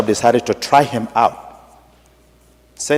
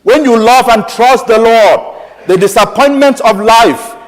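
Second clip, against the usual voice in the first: fervent preaching; 10 semitones higher, 5 dB louder than usual. Acted. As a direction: preach it out to the whole church. Preaching at full force.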